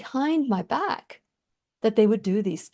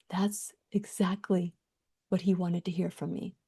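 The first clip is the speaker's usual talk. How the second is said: soft, unvoiced aside; whispered realization; 3 semitones lower, 8 dB quieter than usual. Real, not acted. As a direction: breathe it out quietly. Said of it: "whispered reflection".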